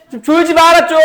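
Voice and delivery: teacher to a dozing student, loud scolding shout; real scolding shout. Real, not acted.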